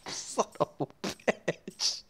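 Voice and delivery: breathless whispered curse through laughter; laughing whisper. Real, not acted. Gamer laughing at the overpowered bow, whispered curse. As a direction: wheeze it out mid-laugh.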